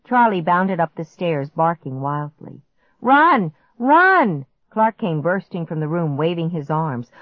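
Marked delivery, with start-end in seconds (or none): 3.0-4.4 high, loud urgent command; panicked shout. A narrator's reading. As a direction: shout for everyone to flee. Clark bursts out, fleeing monster.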